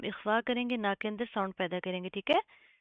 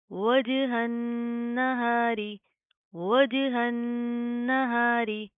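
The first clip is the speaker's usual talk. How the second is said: louder recitation with qalqala bounce on ज; tajweed recitation; 2 semitones higher, 4 dB louder, much slower than usual. Shown as a demonstration. Teacher reciting with qalqala for students. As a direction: bounce the ज in वजहन. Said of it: "कलकला करेंगे".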